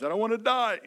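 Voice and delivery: a touch of mock dread; mock fearful whine. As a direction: play the scared believer, half-hammed. Fearful believer voiced in sermon.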